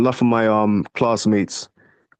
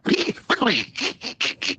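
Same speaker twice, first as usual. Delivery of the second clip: Donald Duck quack; 7 semitones higher, 6 dB quieter than usual. Shown as a demonstration.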